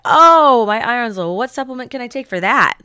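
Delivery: a loud, high "Oh" sliding into a fussy question; hammed-up anxious patient; mock worried patient. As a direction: act the patient hunting for a supplement. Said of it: "So we're like".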